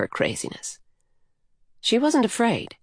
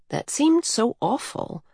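ordinary delivery

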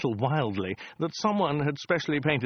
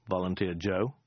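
usual speaking voice

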